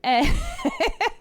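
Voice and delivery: high burst of laughter; short high laugh. Real, not acted. Streamer cracking up at an odd game, laughing.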